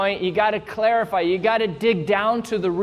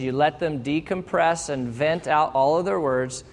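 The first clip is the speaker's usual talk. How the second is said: ordinary talk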